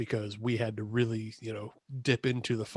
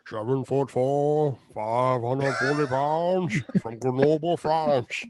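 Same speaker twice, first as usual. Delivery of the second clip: drawn-out, slow wrestling introduction; ring announcer; slower than usual. Acted. Introducing the giant to the ring, drawn-out announcer call. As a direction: announce the giant, stretching every phrase.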